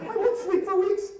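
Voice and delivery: very high, loud wail; hammed-up kid's cry; terrified boy. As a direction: wail it like a traumatized child. Terrified boy complains to mother.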